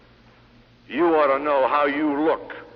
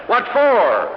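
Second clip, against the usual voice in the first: rhetorical shout; 8 semitones higher, 6 dB louder than usual. Real, not acted. Rhetorical challenge to salesmen.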